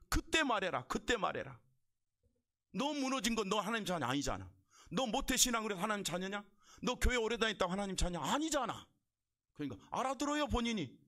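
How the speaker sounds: high-pitched, insistent pulpit challenge; forceful preaching. Real, not acted. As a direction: press the point home to the congregation.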